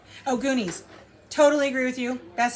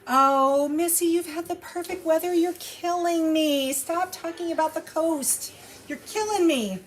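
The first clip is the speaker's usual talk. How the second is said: playful jealous whine; 6 semitones higher than usual. Real, not acted. Jealous of Missy's weather.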